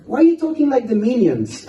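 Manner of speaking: high-pitched imitation of a puzzled kid; kid's incredulous question, voiced through laughter; baffled child. Boy interrupting his father's mantra, comically baffled.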